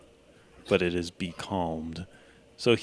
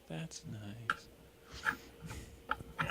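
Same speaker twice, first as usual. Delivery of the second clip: half sleepy; 3 semitones higher, 15 dB quieter than usual. Acted. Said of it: "in a half sleepy voice".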